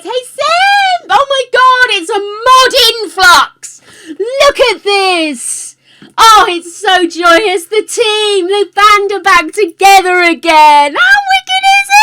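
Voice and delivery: high, loud excited squealing; overjoyed greeting. Real, not acted. Streamer greeting a sudden crowd of mods, overjoyed. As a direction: shout with delight, stretching the big words. Thrilled greeting as moderators arrive together.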